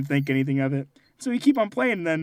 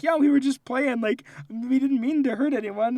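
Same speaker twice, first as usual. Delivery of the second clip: put-on high, earnest child voices; a touch of innocent kids pleading; 8 semitones higher than usual. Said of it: "We were like".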